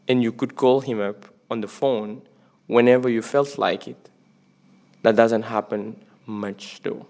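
slow, deliberate quote reading. Real, not acted. Vlogger reads a favourite book quote to viewers, slow and deliberate. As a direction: read it out carefully, word by word. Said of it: "et écoutez bien".

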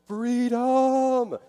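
huge, high battle-cry shout of freedom; triumphant movie-hero roar; William Wallace yell. Retold or imitated. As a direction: bellow it like a warrior charging into battle. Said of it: "like William Wallace, you yelled".

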